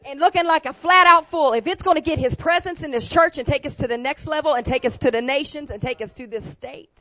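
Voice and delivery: loud, high, driving preacher's cry; preaching at full force. Acted. Pastor urging the church toward its next level, preaching at full force. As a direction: lift the church with every phrase.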